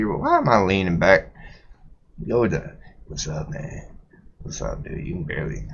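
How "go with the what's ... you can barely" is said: breathy hushed whisper. Pretend. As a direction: barely voice it, peeking. Whispered greeting while peeking.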